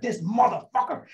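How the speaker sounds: retold flash of rage; snapping in anger. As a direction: lose it at the sergeant. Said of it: "I was like".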